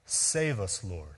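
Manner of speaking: soft, dropped plea; a hushed save us; quiet plea. Shown as a demonstration. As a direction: say it gently, as a prayer. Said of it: "It quite literally means".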